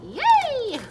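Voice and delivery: a big high loud cheer; loud, high squeal of joy; excited yay. Real, not acted. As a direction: squeal with delight over the money. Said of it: "I'm so excited".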